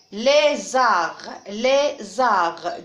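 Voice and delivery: lézard sounded out firmly, lé-zard; teacher's careful syllable demo of "lézard". Shown as a demonstration. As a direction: say "lézard" loud and clear, split as lé / zard. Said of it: "je vais les découper en syllabes".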